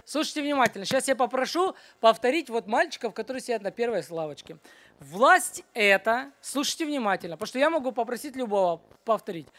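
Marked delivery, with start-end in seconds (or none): 5.0-6.3 very slow, stretched-out words; slow dictation. Real, not acted. Teacher to boys who must repeat a definition, slow dictation. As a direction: draw it out for memorising.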